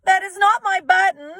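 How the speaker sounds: high, loud shout; frustrated Toad shouting at Frog. A narrator's reading. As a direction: yell it in frustration. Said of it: "shouted Toad".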